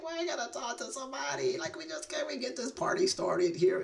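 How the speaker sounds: high, put-on impatient whine; comic high-pitched grumble about waiting; impatient inner voice. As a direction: hurry them along in a squeaky mock complaint. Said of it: "I'm like".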